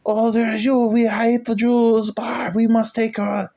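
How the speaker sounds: high, loud mock dictator rant; ranting Hitler. Comic mocking a dictator taking over, high frantic mock rant. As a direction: rant like a wired, skinny dictator high on speed. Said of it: "high up on speed is like".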